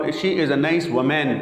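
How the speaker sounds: woman as "wo-MAN"; full "men/man" vowel closing woman; mispronounced woman. Shown as a demonstration. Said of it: "مین کی طرح وومین کہتے ہیں".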